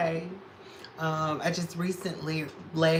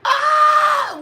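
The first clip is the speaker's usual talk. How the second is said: very high, loud scream; startled high shriek; shocked scream; 12 dB louder than usual. Real, not acted. Surprised scream.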